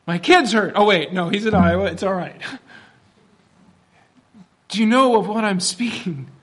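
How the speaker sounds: ordinary talk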